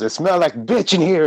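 high yelled quote; mock yell. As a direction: yell it like a skit line. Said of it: "the guy that yells".

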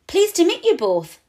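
ordinary delivery

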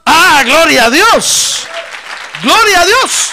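loud, slow, ringing cry of glory; shouted praise. Acted. Preacher crying out praise to his congregation, loud and drawn out. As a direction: lift the church with a full-throated praise shout.

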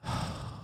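quiet, breathy exhale; acted sigh of wonder; contented sigh. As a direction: sigh softly after the flight. After flying with the bird.

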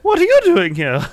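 high startled movie voice; surprised movie character. Pretend. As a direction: play the shocked scientist. Jurassic Park scene voiced.

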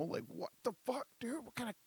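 high, whispered disbelief; shocked aside whisper. Retold or imitated. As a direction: whisper it in horror to yourself. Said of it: "I'm like".

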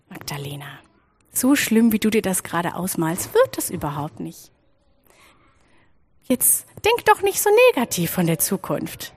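put-on soft, hushed reassurance to a frightened child; gentle comfort. The helper soothes anxious Magdalena, soft and tender.

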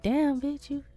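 high, exasperated mock scolding of a teacher; imagined teacher scolding. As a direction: play the disappointed teacher, pitched high and indignant. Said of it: "that's how she was feeling".

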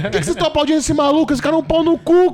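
high, loud, fast indignant rant; mock outraged passenger; indignant outburst. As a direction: protest furiously at the clapping crowd. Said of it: "comecei a ficar, tipo assim, indignado".

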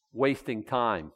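usual speaking voice

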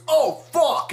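high, hammed-up yelp of alarm; alarmed cry. Retelling Dwayne finding the painting, alarmed yell. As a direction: blurt it high in mock panic.

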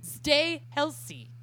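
loud, slow put-on Polish accent. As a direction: boom it out in a thick Polish accent. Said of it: "written in a frenetic Polish accent".

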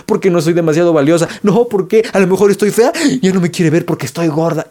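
high, fast worried spiral; anxious inner voice. Retold or imitated. An insecure partner's mind racing when someone runs late, mock panic. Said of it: "tu mente se imaginó mil cosas".

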